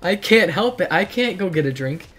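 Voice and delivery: ordinary talk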